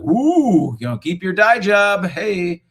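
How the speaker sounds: high, loud mock cheering; hammed-up hooting encouragement; teasing whoop. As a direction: whoop it up to tease a buddy. Acts teasing message to friend.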